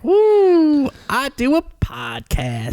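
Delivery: high, loud whoop into drawn-out boast; mock celebration. Making fun of podcasting beside grandpa's war stories, in mock celebration. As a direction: whoop it up, then stretch the words slowly.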